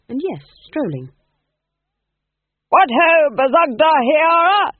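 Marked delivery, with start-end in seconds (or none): 2.7-4.7 loud, high, booming cheer; hearty actor-manager greeting. A narrator's reading. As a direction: hail the dwarf like a grand old thespian.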